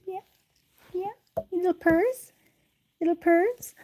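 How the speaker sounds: high cooing to a cat; cooing pet talk. Real, not acted. Talking to the little purring cat, high cooing. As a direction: coo upward, affectionately.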